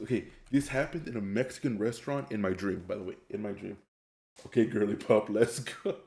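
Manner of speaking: usual speaking voice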